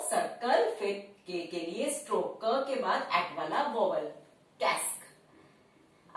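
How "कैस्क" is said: कैस्क whispered very slowly; slow whispered dictation. Shown as a demonstration. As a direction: breathe out कैस्क slowly for the class. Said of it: "word है कैस्क". (कैस्क को फुसफुसाते हुए बहुत धीरे बोलिए)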